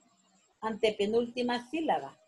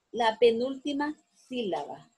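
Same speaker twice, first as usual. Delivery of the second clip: ordinary talk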